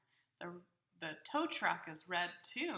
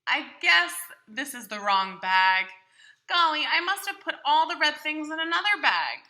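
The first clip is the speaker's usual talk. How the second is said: higher, louder, cheerful Big Bird puzzling over his bag; 7 semitones higher, 16 dB louder than usual. Acted. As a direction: play a big friendly bird talking to the child. Reader voicing Big Bird's lines.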